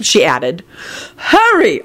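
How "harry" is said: high, exclaimed name call; bellowed. A narrator's reading. Arthur rounding on Harry, mild bellow. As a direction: cry out the name, pitched way up. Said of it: "Arthur bellowed".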